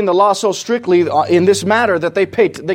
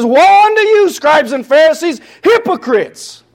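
much higher and louder, hurling it at them; outraged rebuke; 11 semitones higher, 5 dB louder than usual. Shown as a demonstration. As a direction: denounce them at full force. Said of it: "Do we really think he said it like that? No".

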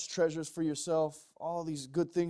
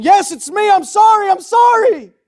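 desperate high screamed plea; frantic, full-volume acted wailing; begging forgiveness; 25 dB louder than usual. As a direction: scream the apology as a sinner begging mercy. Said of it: "fall to your knees weeping".

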